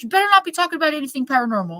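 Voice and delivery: fast, high warning; mock stern non-believer; stern stepfather. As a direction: rush the warning out. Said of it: "he's like".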